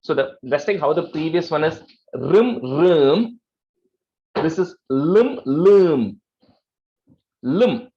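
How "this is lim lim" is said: slow, louder lim with syllabic l; correct Sanskrit lim. Shown as a demonstration. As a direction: say lim carefully, neither lum nor plain lim. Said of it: "So it's not lum".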